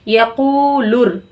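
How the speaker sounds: यकूलुर drawn out very slowly, syllables joined smoothly; slow joined reading. Shown as a demonstration. Teacher shows students how to join यकूलुर slowly. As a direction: blend यकूलुर slowly, lam before ra. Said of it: "इस तरीके से पढ़ना है।".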